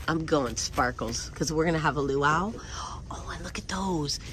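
excited whisper. Real, not acted. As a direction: let them in on a secret. Secretive aside while shopping.

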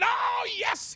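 very high shouted oh yes; shouted amen. Retold or imitated. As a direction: burst out in praise. Woman shouts out in quiet church.

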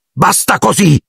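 high-pitched cry to stop; shout. A narrator's reading. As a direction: cry out to stop the elf, without full volume. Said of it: "gridò Harry afferrando l'elfo". (grido acuto, trattenuto)

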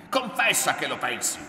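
loud, high, furious order; angry yell, hammering the words; shouted demand. A narrator's reading. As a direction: force a confession out of them. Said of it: "gridò".

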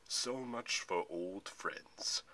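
very slow, deep villain drawl; gloating villain. A narrator's reading. The general mocks Thalia and Luke, gloating. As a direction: draw it out with dark amusement. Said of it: "the general chuckled".

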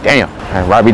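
ordinary talk

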